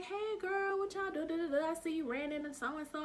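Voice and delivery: high, sing-song imitation of a friend on the phone; girlfriend voice. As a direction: play the bubbly girlfriend, light and high.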